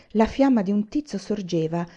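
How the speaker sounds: plain reading voice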